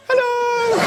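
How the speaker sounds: very high, bright hello; squeaky hello. Retold or imitated. Girl caught in bed answering, very high hello. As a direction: chirp it back high. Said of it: "she looked at me and went".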